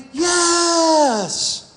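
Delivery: put-on high, eager children's yell; high-pitched mock kids' scream; kids screaming yes. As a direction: shout it like a room of keen kids. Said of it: "They all screamed".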